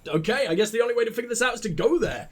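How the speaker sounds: usual speaking voice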